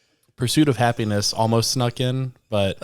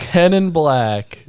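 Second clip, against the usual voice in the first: slow loud echo; 7 semitones higher, 9 dB louder, much slower than usual. Acted. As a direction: stretch each word and lean on it. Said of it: "I was about to say that. That's good".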